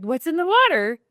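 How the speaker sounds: high, loud burst of amazement; playful retold astonishment; amazed. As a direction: exclaim it, astonished at the sight. Retold amazement at good-looking people.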